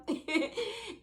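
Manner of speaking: bright high burst of laughter; real laugh. Real, not acted. Laughing at her own meek answer to the nurse, a real high laugh. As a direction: laugh at yourself, high and quick.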